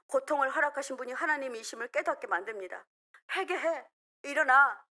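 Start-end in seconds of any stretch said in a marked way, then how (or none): 3.3-4.8 urgent rebuke with a loud cry of "일어나"; stern rebuke. Acted. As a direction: scold yourself to get up. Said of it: "이렇게 말한 것입니다". (자신을 꾸짖듯 단호하게)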